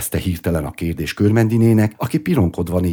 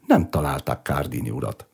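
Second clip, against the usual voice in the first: ordinary delivery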